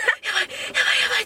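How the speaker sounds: high, unvoiced panicked murmur; panicked whisper. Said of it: "ってなって".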